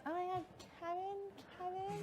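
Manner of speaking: slow, high swooning fangirl voice; mock swoon. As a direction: gush over Kevin like a smitten fan. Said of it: "She goes".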